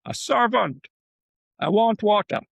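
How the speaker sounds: calling out. Retold or imitated. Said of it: "He calls out to a servant".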